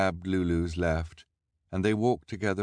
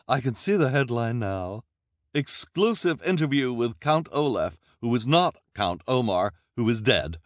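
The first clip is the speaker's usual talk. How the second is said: gushing reporter; 7 semitones higher, 6 dB louder than usual. A narrator's reading. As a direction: spell out the headline with relish. Reporter voiced.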